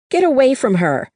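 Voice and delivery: high, loud desperate yell. A narrator's reading. Granddaughter yelling at the man smothering her grandmother. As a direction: scream it at the attacker to stop him. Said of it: "I yelled".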